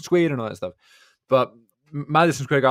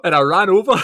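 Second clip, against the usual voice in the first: excited; 7 semitones higher, 9 dB louder than usual. Real, not acted. Gasps at the screen.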